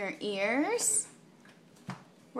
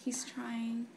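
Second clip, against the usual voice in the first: whisper; 9 dB quieter than usual. Real, not acted. Aside while baby attempts words.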